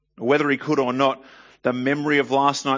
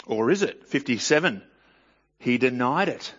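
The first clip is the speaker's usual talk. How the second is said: ordinary talk, nothing marked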